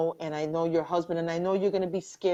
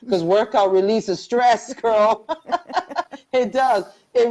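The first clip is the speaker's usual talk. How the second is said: loud, higher, through laughter; laughing insistence; 4 semitones higher, 9 dB louder than usual. Real, not acted. Urging her friend to work out, laughing insistence. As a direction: insist on it while cracking up.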